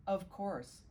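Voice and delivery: ordinary delivery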